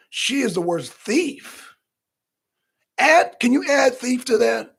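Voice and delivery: ordinary talk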